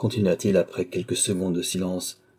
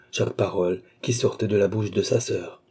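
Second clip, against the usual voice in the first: usual speaking voice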